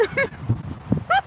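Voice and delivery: high, breathy laugh held back; a laugh on the edge of tears; a high, airy laugh of surprise; emotional laugh. Real, not acted. Moved by fan emails.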